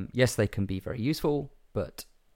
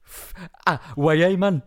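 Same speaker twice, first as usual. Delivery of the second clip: Geordie "way, man", higher and louder; hammed-up Geordie "way, man"; Geordie accent; 8 semitones higher, 7 dB louder, slower than usual. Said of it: "read out in a Geordie accent".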